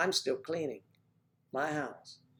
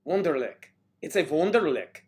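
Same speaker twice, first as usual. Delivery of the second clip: a light mock German accent on Wunderlich, vunderlich; lightly hammed German accent; 4 semitones higher, 5 dB louder than usual. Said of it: "My apologies to those who speak with accents".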